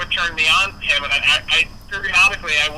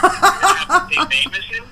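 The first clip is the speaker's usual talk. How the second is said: laughing disbelief; 4 dB louder than usual. Retold or imitated. His amused question to George.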